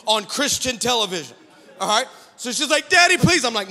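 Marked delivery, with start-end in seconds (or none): 2.9-3.4 high, loud mock child's plea; crying plea. Crying daughter pleading with dad, imitated whine. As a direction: beg like a tearful little girl. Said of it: "a crying seven-old girl".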